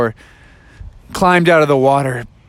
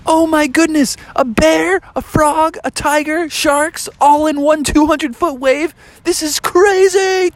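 higher, excited gasping exclamations; amazed onlookers; 10 semitones higher than usual. Acted. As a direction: gush like stunned beach crowd. Beachgoers amazed.

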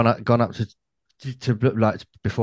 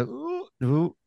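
high-pitched wincing ooh; awkward ooh; 13 semitones higher, 3 dB quieter than usual. Acted. Reacting to being called the wrong name, awkward ooh. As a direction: let out two high, awkward oohs. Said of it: "they do it again and you go".

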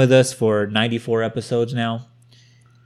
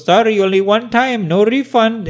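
shopkeeper voice; 10 semitones higher, 5 dB louder than usual. Retold or imitated.

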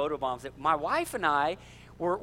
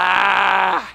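playful shout; 14 dB louder than usual. Pretend. Camel voiced in photo.

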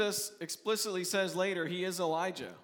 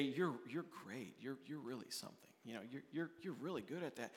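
softer, lower, coaxing flatterer; soft flattery; 3 semitones lower, 9 dB quieter than usual. Acted. Tempting voice flattering John, soft and coaxing. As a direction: whisper praise into his ear.

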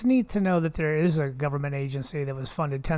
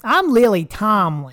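Lily Tomlin impression; 4 semitones higher, 5 dB louder, slower than usual. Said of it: "It's a pretty good impression".

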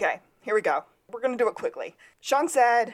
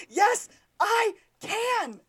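high, indignant outburst building louder; Zapato; 13 semitones higher, 5 dB louder than usual. Acted. Podcaster acting the wounded star claiming his comeback, high indignation. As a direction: hammer each MY in a high wail. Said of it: "that's when Zapatos goes".

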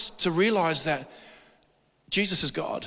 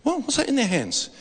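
much higher, mock-nervous questions; mock alarm; 7 semitones higher than usual. Preacher acting a nervous onlooker spotting stones, mock alarm. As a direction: play up the panic at the stones. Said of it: "And I was like".

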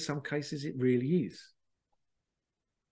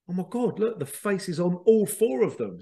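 higher, louder astonished cry; imitating an excited onlooker, pitched up; amazed exclaim; 7 semitones higher, 6 dB louder than usual. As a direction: retell the audience gasping in awe. Audience retold seeing faces.

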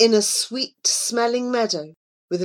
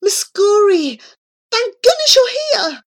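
loud, high cry of relief; relieved exclamation; 13 semitones higher, 7 dB louder than usual. A narrator's reading. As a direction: cry out, overjoyed to find a friend. Abu greets returned friend, relieved.

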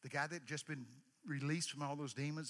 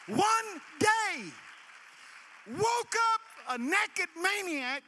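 preaching at full force; 12 semitones higher, 9 dB louder, slower than usual. Real, not acted. Climactic preaching.

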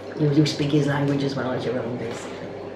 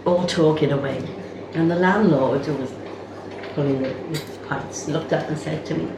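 ordinary talk

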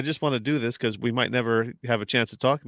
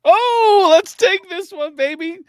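high, loud, slow hype call; hyped announcer; 8 dB louder, slower than usual. Acted. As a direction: hype it up like a game host. Kicking off stick or flip segment.

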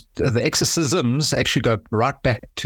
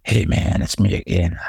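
low, whispered-then-growled demon voice; imitating a stock horror demon, low and menacing; mock movie demon; 4 semitones lower than usual. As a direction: play the cliché exorcism demon, dropped low. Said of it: "the next Exorcist movie is like".

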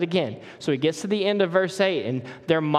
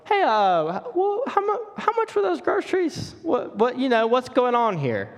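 high, halting worried questions; hammed-up fretting spouse, pitched way up; mock money panic; 9 semitones higher than usual. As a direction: start spiralling over the bills, squeaky and stammering. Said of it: "starts to spiral a little bit".

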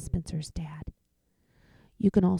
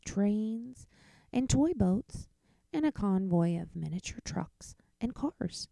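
plain reading voice

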